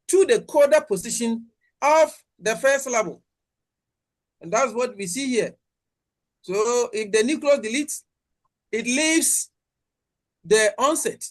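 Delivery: usual speaking voice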